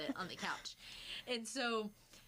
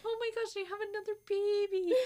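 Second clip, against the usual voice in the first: high, loud, gushing; giddy delight; 11 semitones higher, 5 dB louder than usual. Retold or imitated. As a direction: gush over the new elf like a child. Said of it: "she was like".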